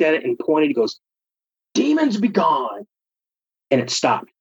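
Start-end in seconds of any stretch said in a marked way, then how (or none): 1.7-2.9 mock exorcism command. Friend points at buzzing light.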